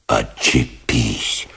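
slow, low, sheepish plea; embarrassed, drawn out. A narrator's reading. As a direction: drag it out, abashed. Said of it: "смущённо и протяжно попросил".

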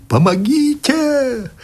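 очень высоко, протяжно; крик о помощи, срывающийся; отчаянный крик. Acted. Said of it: "а потом крикнул". (мужик кричит доктору о помощи)

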